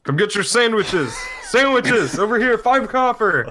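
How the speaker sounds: high, loud street hawker's call; shouting vendor. Acted. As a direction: holler to draw a crowd to your sandwich stall. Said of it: "he starts shouting at them".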